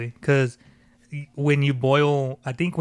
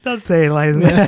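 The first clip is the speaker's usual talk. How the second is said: louder, higher, words broken by laughter; laughing protest; 4 semitones higher, 7 dB louder than usual. Real, not acted. Friend still objecting to the gene joke, laughing protest. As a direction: plead through real giggles.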